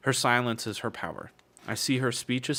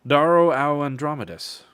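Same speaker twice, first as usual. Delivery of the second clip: louder growled greeting from a huge praetor; growling big man; 6 semitones higher, 9 dB louder than usual. Acted. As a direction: growl the name like a giant warrior. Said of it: "Karnas growls".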